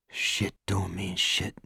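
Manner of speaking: hushed, slow, to himself; soft slow mantra. A narrator's reading. Morris after the assault, softly repeating his mantra. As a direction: murmur it slowly to yourself. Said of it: "he told himself".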